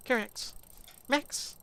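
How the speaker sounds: high sing-song call; calling the dog. Real, not acted.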